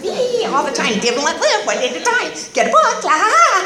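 high, sing-song mockery of chirpy do-gooders; mock cheery AA members. Mocking the bright-eyed meeting regulars, high and sing-song. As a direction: parody the relentlessly cheerful crowd with a giggle.

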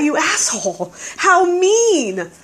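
mock outrage at the biker's jab; indignant inner thought. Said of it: "I thought".

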